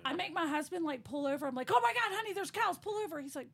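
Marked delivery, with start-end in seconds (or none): 1.7-3.2 high, loud, thrilled plea; excited squeal. Retold or imitated. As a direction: gush at the cows like a kid. Begging husband to stop for cows.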